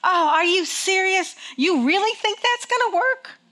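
high-pitched mocking doubt; devil. Devil taunting a believer to convince them, mock incredulous. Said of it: "He's gonna convince you".

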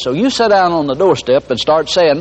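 usual speaking voice

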